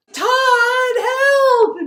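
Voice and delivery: very high, loud mock scream; shrieked comic distress call; cry for help. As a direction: scream for rescue, hammed up.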